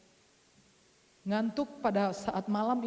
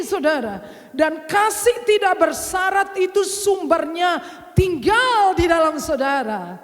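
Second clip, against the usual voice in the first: shouted, high sermon climax; full-force preaching; 6 semitones higher, 10 dB louder than usual. Acted. Preacher urging the congregation to answer, shouting. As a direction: call the congregation to say yes.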